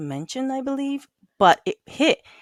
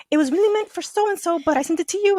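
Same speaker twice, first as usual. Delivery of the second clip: high-pitched retold explaining; acting out an awkward excuse; sheepish excuse; 10 semitones higher than usual. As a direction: explain it away in a high, innocent voice.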